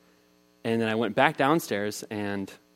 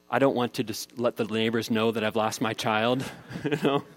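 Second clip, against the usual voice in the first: usual speaking voice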